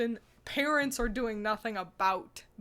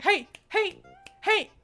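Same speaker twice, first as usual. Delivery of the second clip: higher, louder hey, hey, stop at an annoying person; dismissive call-out; 7 semitones higher, 5 dB louder, slower than usual. Shown as a demonstration. Showing how she shuts down someone who annoyed her, sharp call-out. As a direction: assert dominance with a curt hey. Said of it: "I do that when I actively disrespect someone".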